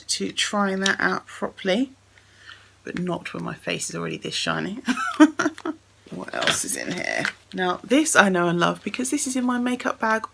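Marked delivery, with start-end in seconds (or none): 4.9-5.7 short, high burst of laughter; quick, loud self-amused laugh. Real, not acted. Laughs at her shiny face.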